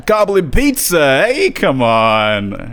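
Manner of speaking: loud, drawn-out mock New York holler; broad, stretched-out caricature New Yorker; New York accent. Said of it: "everyone from her part of New York".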